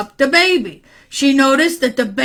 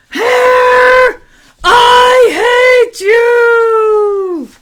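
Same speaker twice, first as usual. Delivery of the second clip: high, loud, long-drawn shout; angry yell; 11 semitones higher, 7 dB louder, much slower than usual. Acted. Boy mad at his own hair, angry yell. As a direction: yell it furiously, stretching the words out. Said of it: "got so mad he yelled".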